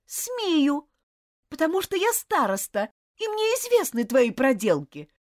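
loud, high, icy superiority; cold malice. Acted. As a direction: lord it over him coldly. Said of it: "с холодной злобой".

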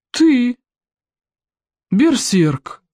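"Ты" high and drawn out, then "берсерк" falling after a pause; high, disbelieving "Ты", pause, "берсерк"; incredulous question. A narrator's reading. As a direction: question each word separately: "Ты"... "берсерк". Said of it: "переспросил вождь".

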